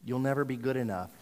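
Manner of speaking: normal talking voice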